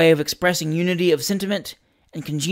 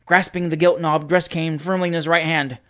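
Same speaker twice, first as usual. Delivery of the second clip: usual speaking voice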